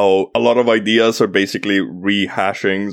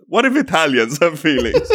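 higher voice breaking into laughter; laughing punchline; 7 semitones higher than usual. Real, not acted. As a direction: crack up while delivering the punchline. Reads meme punchline, cracking up.

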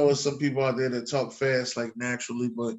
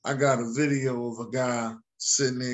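usual speaking voice

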